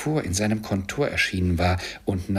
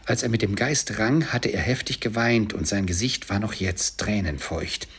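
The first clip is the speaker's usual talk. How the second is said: ordinary delivery, nothing marked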